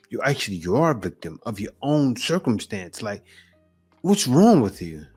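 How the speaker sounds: usual speaking voice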